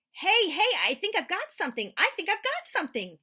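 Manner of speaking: high, loud, eager cry of a hooked catch; boy shouting he's got a bite; excited. Acted. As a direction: call it out thrilled. Boy feels tug on fishing line.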